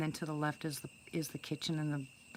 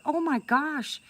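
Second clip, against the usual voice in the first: much higher and louder, startled cry; shocked gasp; 11 semitones higher, 8 dB louder, slower than usual. Retold or imitated. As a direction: recoil in disbelief as it stares at you. Creature stares her in eye.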